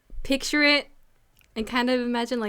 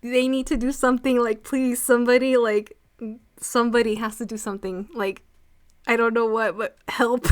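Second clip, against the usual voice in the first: loud, exasperated plea for action; re-enacted frustrated outburst; annoyed pleading; 6 dB louder than usual. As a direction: beg someone to step in, loudly. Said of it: "I was so annoyed".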